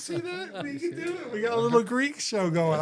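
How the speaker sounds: thrilled, bouncing praise; excited cheer. Real, not acted. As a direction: cheer her on like a proud host. Cheering colleague speaking Greek.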